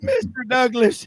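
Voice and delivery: hint of a peddler hailing a customer; Mister Haney. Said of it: "was the name of Mister Haney. He goes".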